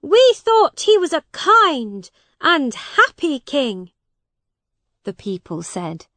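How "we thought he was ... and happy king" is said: high, loud complaint from onlookers; loud, pitched-up protest; crowd calling out. A narrator's reading. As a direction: raise your voice for the whole crowd.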